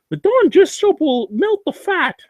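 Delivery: slow, very high silly know-it-all voice; drawn-out, high-pitched parroting; mocking internet advice. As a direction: quote a useless tip in mocking falsetto. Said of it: "every stupid internet idea out there".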